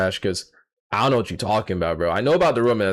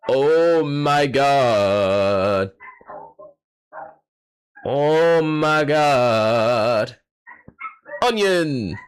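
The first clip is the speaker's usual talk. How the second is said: 1 stunned drawn-out awe; 2 semitones higher, much slower than usual. Real, not acted. Stunned by the onion hit.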